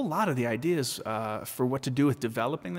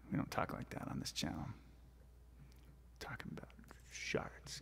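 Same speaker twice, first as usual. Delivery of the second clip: hushed mock-secret whisper; 10 semitones lower, 18 dB quieter than usual. Real, not acted. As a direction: whisper it like a forbidden topic. Joking aside about gross topic.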